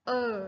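long เออ vowel, like er; er sound. Shown as a demonstration. Teacher modelling the third U sound, long เออ. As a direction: say เออ as a held er. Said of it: "เสียงที่สาม เสียงเออ".

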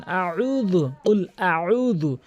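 very slow কুল আউযু, each sound drawn out; slow recitation drill. Shown as a demonstration. Teacher having students practise with him, slow chant. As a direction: recite it slowly for pupils to copy. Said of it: "থামিয়ে থামিয়ে practice করবেন".

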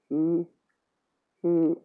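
slow, high, hushed disbelief; small, halting voice of stunned realisation; amazed murmur. A narrator's reading. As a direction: stammer it out as the truth dawns. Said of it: "murmured Tom, amazed".

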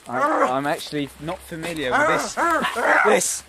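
high loud frightened voice; rising panic as danger nears; panicked. Acted. Bears approaching, panicking.

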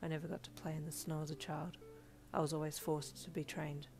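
half-sad. A narrator's reading. Todoroki recalling his childhood to Midoriya, softly sad. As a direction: confide it quietly, a touch forlorn. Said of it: "half-sad voice".

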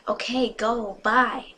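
understated shout to send someone off; mild yell. A narrator's reading. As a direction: call it out with moderate force. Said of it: "I yelled".

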